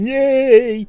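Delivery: high, loud sarcastic cheer; mock cheer. Mocking Californian fans cheering, high and loud. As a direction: squeal it like a clapping fan. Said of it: "clapping their hands going".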